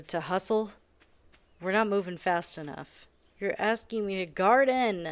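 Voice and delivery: ordinary delivery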